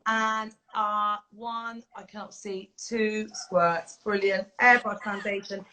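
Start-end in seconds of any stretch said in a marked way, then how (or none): none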